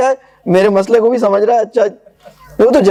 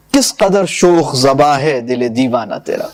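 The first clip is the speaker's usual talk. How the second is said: measured, lower verse recital; poem recitation; 7 semitones lower than usual. Acted. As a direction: recite the couplets with gravity. (شاعرانہ انداز میں پڑھت)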